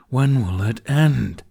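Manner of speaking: slow, loud hammed-up moan; milk the drama; dramatic moan. A narrator's reading. Toothless complaining to Hiccup about goodbyes, slow dramatic moan. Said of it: "he moaned with dramatics".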